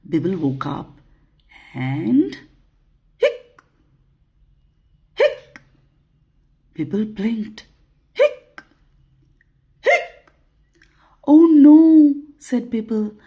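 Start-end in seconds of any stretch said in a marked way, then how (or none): 3.2-5.6 short high squeaky hiccup; spoken cartoon hiccup; little high-pitched hic; mock hiccup. Imitates the monster's hiccups. 8.1-10.3 short high squeaky hiccup; spoken cartoon hiccup; little high-pitched hic; mock hiccup. Imitates the monster's hiccups. 11.3-12.1 high, loud cartoon "oh no"; storybook monster in mild alarm; dismayed little monster. Monster dismayed at his hiccups.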